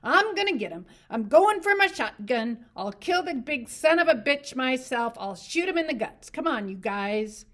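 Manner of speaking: high and loud, furious; rage, working up to a fury. A narrator's reading. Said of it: "He worked himself into a fury".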